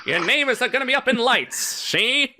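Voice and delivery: high-pitched 1940s movie-mogul patter; hammed-up old Hollywood producer voice; Hollywood producer man. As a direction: promise stardom like a forties producer. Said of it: "This is my Hollywood producer man voice".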